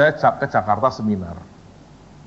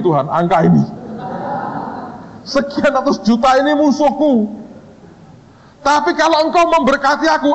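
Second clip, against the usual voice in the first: fervent prayer; 10 semitones higher than usual. Retold or imitated. Preacher reenacts his fervent prayer.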